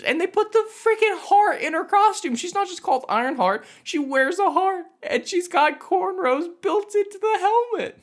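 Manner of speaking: rant, voice climbing in outrage; incredulous high rant at the costume design; exasperated rant. Real, not acted. Ranting about the CGI costume.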